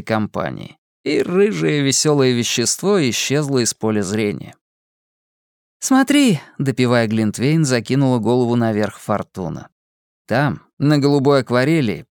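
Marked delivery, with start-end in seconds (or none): 5.8-6.4 put-on bubbly sorority girl. A narrator's reading. Fortuna calls attention.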